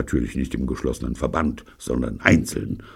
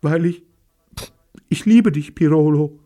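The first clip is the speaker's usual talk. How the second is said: put-on girl confessing love; 8 dB louder than usual. A narrator's reading.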